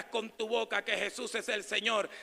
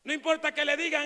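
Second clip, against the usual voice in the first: usual speaking voice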